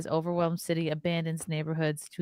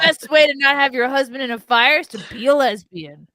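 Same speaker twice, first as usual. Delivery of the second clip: loud firefighter shout, higher; firefighter shouting through mask; 6 semitones higher, 8 dB louder than usual. Pretend. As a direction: bellow it like a firefighter on scene.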